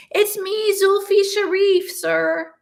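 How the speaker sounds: hint of a fussy young student; a ghostly student calling out to his professor; lightly voiced student. A narrator's reading.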